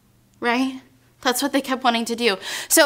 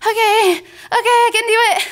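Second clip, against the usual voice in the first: higher, hurried nervous agreement; nervous self-reassurance; 7 semitones higher, 3 dB louder than usual. Retold or imitated. Frightened rider answering a reassuring friend, nervously. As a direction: act out a jittery attempt at courage.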